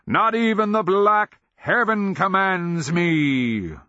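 slow, loud, high thundering roar; thundering beast. A narrator's reading. Goaded dragon boasting of its power, thundering. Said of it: "the scales-shining beast thundered".

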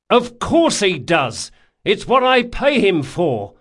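loud, high dismissal; blustering retort. A narrator's reading. Captain to a complaining sailor, loud bluster. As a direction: brush off the complaint at full volume.

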